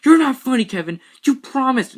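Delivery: high, loud accusing yell; angry shout. Acted. Boy yelling at his brother about the promise, angry. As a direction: throw the broken promise at him.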